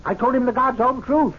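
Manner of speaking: high, wounded protest; indignant denial. Acted. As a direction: voice jumps up, hotly protesting.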